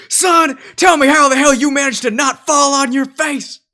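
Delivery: drill sergeant. Acted. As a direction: bellow at a clumsy recruit. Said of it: "I feel like a drill sergeant".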